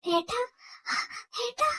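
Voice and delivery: rising, tearful plea; short, pleading cries; tearful calling. Acted. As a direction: call out to a brother on the edge of crying. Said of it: "കരയാതെ".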